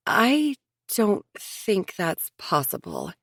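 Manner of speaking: slow, measured, choosing each word; careful. A narrator's reading. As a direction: tread gently, slowly. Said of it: "So Leona said carefully".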